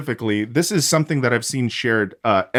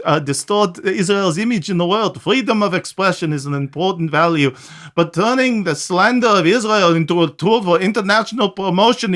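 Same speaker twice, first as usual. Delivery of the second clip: mock Israeli accent; 6 semitones higher than usual. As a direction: read the minister's statement in a hammed-up Israeli accent. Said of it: "It, it was already pretty Yiddish coded".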